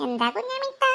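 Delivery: put-on a just-woken kid asks what's up; child's voice. Sleepy child asks aunt. (കുട്ടിയുടെ ശബ്ദത്തിൽ ചോദ്യം)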